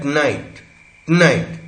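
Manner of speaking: "tonight" with no vowel between t and n; syllabic-consonant pronunciation. Shown as a demonstration. Teacher showing connected speech, vowel-dropped pronunciation. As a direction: run t straight into n in "tonight", like "tnight". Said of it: "syllabic कॉन्टॉइड या syllabic consonant".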